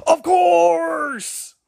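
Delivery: high shrieking mock "Of course!"; imitating Cenk's shout. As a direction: squeal it like a ranting host. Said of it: "when I listen to him go".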